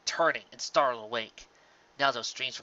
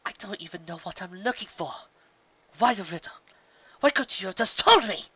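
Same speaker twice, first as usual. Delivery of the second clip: frustrated outburst; 6 semitones higher, 5 dB louder than usual. Acted. Luna frustrated at riddle.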